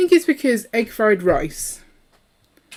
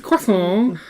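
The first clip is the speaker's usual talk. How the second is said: badly faked French-Chinese croissant; hammed-up French-Chinese blend; French-Chinese accent; 2 semitones higher, 4 dB louder than usual. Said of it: "now I'm doing French Chinese".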